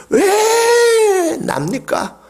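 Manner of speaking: very high, slow, sobbing shout; a sobbing cry of why me. Acted. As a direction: wail it out as if breaking down. Climax of the voiced lament.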